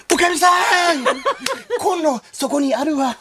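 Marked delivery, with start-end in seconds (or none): 0.1-1.0 calling out. Acted. Acted stage line, calling out.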